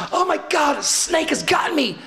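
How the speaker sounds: retold panic. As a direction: relive the shock of a snake bite. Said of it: "It's like".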